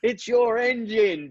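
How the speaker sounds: put-on high, slow American accent, laying blame; a slow, high American jab at the factory; American boss pinning the blame on the engine; exaggerated American accent. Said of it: "I won't do the accent 'cause I'm crap at accents".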